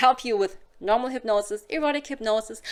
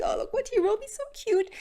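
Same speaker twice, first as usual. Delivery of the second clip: gushing; 11 semitones higher, faster than usual. Acted. Showing text to colleague.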